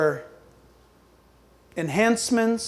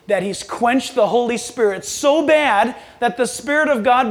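usual speaking voice

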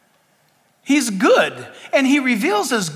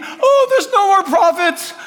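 whining Elijah; 10 semitones higher, 5 dB louder than usual. Acted. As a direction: wail hammed-up self-pity at God. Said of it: "Remember Elijah's whining?".

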